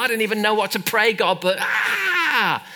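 high, strained plea ending in a long cry; anguished groaning prayer. Retold or imitated. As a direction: re-enact a helpless, tearful prayer. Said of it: "And I sigh".

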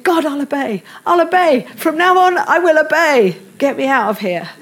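hammed-up plea to get out; mock desperate vow. Preacher retelling her frantic promise to God, mock pleading.

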